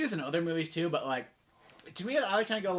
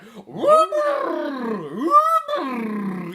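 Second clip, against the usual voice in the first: high, drawn-out vocal whoop; loud rising whoop, mimicking a sound effect; imitated whooping noise; 6 semitones higher, 7 dB louder than usual. Co-host mimicking the odd noise over the studio logo, long whoops. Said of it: "then you just hear, like".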